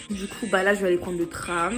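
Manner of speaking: ordinary delivery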